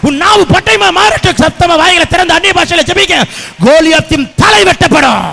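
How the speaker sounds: loud, driving revival sermon; relentless shouted proclamation to rouse the congregation; full-force preaching. Real, not acted. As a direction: hammer each phrase out at a shout.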